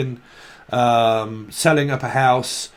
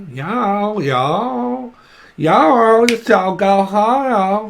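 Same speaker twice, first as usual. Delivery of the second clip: each y'all pitched higher, ending on a loud squeaky rise; going higher; 6 semitones higher, 4 dB louder, much slower than usual. Shown as a demonstration. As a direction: climb up into a high mock Southern voice.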